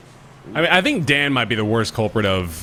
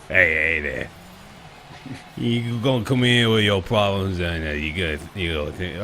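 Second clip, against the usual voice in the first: lightly put-on Louisiana Southern accent; 4 semitones lower, 3 dB quieter than usual. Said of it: "uncomfortably Louisianish".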